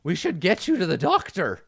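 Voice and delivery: high, earnest character voice; concerned, high-voiced illusionist; skeleton illusionist. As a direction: pitch it high and stress the concern. Game master voices Fezzik.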